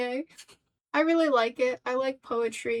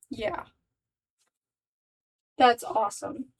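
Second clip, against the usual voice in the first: ordinary delivery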